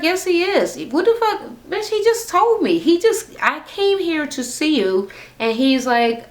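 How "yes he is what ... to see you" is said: high-pitched indignant mock protest; indignant retort. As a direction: argue back heatedly, pitched way up. Retold sisters' quarrel, arguing back.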